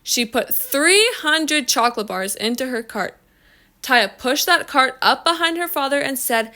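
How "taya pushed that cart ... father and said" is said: usual speaking voice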